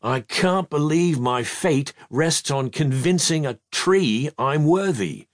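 plain reading voice

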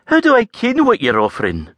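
high, lilting Scots lass; Avril's voice. A narrator's reading. Scottish lass answering the stranger. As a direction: voice the saucy Scottish farm girl.

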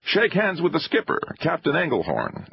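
high-pitched, insistent introduction; impatient host, pitched well up; pushing on. A narrator's reading. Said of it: "Denham pushed on".

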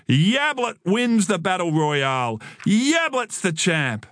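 high-pitched victory cry; drawn-out boast over the taunts; triumphant shout. A narrator's reading. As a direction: crow like a kid champion. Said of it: "Joel shouted above the twins' taunts".

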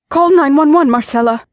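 high, loud command; urgent order. A narrator's reading. Wife ordering the housekeeper to call for help, urgent and loud. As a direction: bark the order at the maid. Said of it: "the missus ordered".

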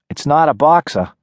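put-on wary girl calling from a porch; tough city girl. Nadia correcting Bob.

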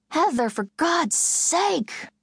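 high, slow shout through the door. A narrator's reading. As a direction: yell it at the closed door, exasperated. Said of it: "Julia shouted".

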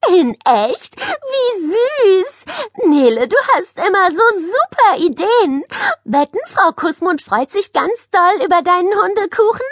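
put-on high, loud girlish gushing; excited squeal. A narrator's reading. Josephine gushing over her classmate's dog cake, squealing. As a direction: shriek with delight, pitch soaring. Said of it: "stieß einen Schrei aus".

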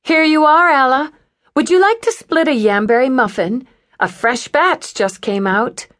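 high, bright, quick server voice; cheerful waitress. Acted. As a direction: breeze in oblivious and chipper. Cheerful waitress interrupts tense moment.

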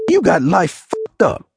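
high, heated demand; angry outburst. A narrator's reading. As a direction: lash out, then break off mid-sentence. Furious demand, cut off mid-sentence.

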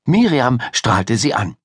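usual speaking voice

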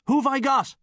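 shouting across the pitch to a teammate; shouted. A narrator's reading. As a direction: yell it across the field. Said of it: "he shouted across at Paddy".

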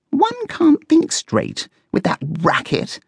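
high, slow, prim grumble; drawn-out, high-pitched annoyance; peeved complaint. A narrator's reading. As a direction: complain slowly in a high character voice. Coco complains about the noise.

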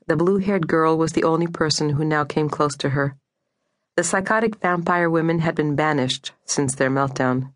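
ordinary delivery

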